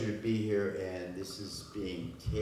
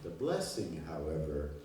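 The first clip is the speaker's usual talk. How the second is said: measured poetry reading with pauses between phrases; poem recitation; 5 dB louder than usual. Acted. Poet opens a reading for a cafe audience, measured recitation. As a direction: declaim each line, letting it settle before the next.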